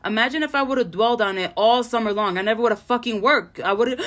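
normal talking voice

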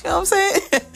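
high laughing question. Real, not acted. Laughing at being the mushy auntie.

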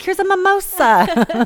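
playful mock-cheerful hostess; chirpy hostess offer. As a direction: brightly push a drink on her.

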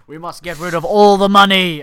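villain shout. Retold or imitated. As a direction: bellow it like a cartoon villain. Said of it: "He's like".

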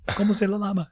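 high, sing-song "Como se la llama?" with an extra "la"; playful mock Spanish. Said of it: "looked at her and".

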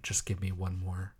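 hushed, breathy plea for a fix; mock junkie whisper. Cohost jokes about a heroin craving, whispering.

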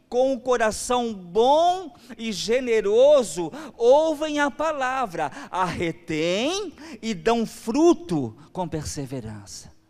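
loud, slow emphatic preaching; preacher reading scripture with fervour; full-force preaching. Acted. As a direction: drive the verse home to the church.

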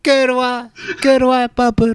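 high, loud Polish yelling; Polish kids yelling. Retold or imitated. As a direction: yell at the beaver like a Polish kid. Imitating Polish kids yelling at beavers.

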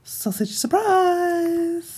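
very high, slow squeal; silly character voice. Pretend. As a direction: squeal it out like a cartoon. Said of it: "What if you talked like this".

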